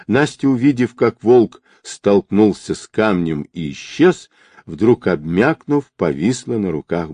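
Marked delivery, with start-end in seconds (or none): none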